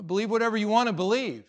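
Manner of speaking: normal talking voice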